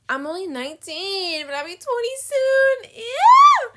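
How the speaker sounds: rising from high whine to loud shriek; hammed-up horror climbing into a shout; dramatic dismay. Real, not acted. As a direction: wail it, building to a piercing "ew". Dreading turning twenty, playfully dramatic.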